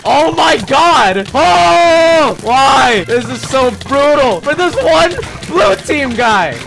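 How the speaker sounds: excited disbelief. Real, not acted. Brutal fight on screen.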